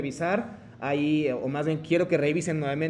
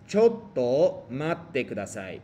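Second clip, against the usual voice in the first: very slow, every syllable laid out; drawn out so students can copy; slow model phrase; 3 semitones lower, much slower than usual. Shown as a demonstration. Teacher modelling for students to repeat, very slow. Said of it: "Otra vez".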